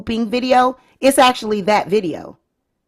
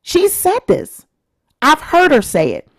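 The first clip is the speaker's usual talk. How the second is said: normal talking voice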